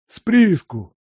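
high, loud startled query; surprised question. A narrator's reading. Servant asking about the mine, high and surprised. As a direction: blurt the question out.

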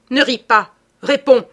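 explosive imperative; angry command. A narrator's reading. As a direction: demand an answer, shouting. Furious order to answer.

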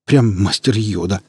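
plain reading voice

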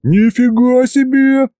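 high slow startled cry; boy lifted by the trunk yells in fright; frightened yell. A narrator's reading. High frightened yell. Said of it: "опять заорал я только от страха".

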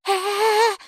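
very high drawn-out squeal; creature's squeal. A narrator's reading. Creature answers Kaapo's offer to help, high squeal. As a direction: squeal like a startled little creature. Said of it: "взвизгнуло существо".

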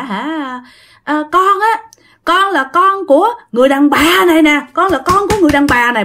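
imitated wailing. Spirit revealing whose child it is, wailing child voice. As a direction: accuse like an aggrieved child. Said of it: "Lúc đó dì của bạn mới gào lên".

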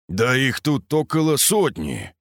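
ordinary delivery

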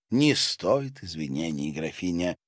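ordinary delivery, nothing marked

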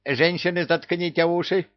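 high parrot cry; yelling parrot. A narrator's reading. Parrot warning the women before translating, high yell. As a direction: call out like a squawking bird. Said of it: "завопил попугай".

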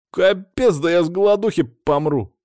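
loud, high whinge; put-upon schoolboy grumble; sulky complaint. A narrator's reading. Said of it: "насупился Саня".

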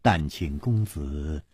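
soft, slow apology; apologetic sigh. A narrator's reading. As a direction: apologise quietly and slowly after a sigh. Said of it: "王妃又叹了一口气".

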